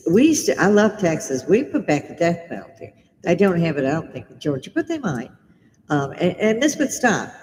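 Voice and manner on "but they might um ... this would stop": ordinary delivery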